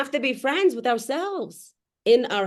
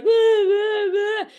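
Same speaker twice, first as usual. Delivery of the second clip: loud, higher dismissive blah; mocking blah blah; 10 semitones higher, 5 dB louder, slower than usual. Mocking the crying contestant, loud dismissive blah. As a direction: brush off his whining loudly. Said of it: "and he's like".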